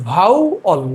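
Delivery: "Vowel" as bhau, then ol; split into syllables. Shown as a demonstration. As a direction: break "vowel" in two with a short gap. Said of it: "এটার pronunciation হচ্ছে". ("Vowel" দুই সিলেবলে ভেঙে)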